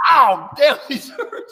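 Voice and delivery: loud, high "ow" through laughter; laughing cry of pain; pained yelp. Real, not acted. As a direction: yelp in pain, pitch shooting up. Real pain, yelping amid laughter.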